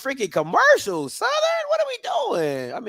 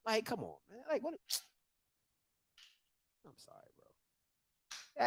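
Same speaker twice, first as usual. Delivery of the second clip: whispered aside; 2 semitones higher, 18 dB quieter than usual. Real, not acted. Exasperated aside.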